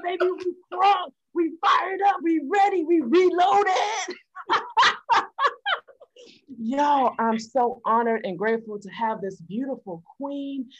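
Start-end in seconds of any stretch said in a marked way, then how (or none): 0.0-4.1 shouted hype chant, building. Real, not acted. Hype man opening shout. 4.4-5.8 bright high cackle; high laugh. Real, not acted. Laughs after hype.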